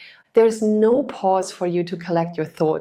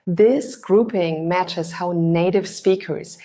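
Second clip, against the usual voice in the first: ordinary talk, nothing marked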